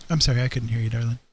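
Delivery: ordinary talk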